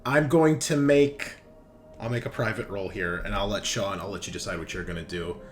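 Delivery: usual speaking voice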